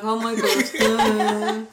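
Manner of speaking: loud, drawn-out mock horror with laughter; retold dismay. Guest retells realising her mistake about the accent, dismayed. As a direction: stretch it out slowly, half laughing.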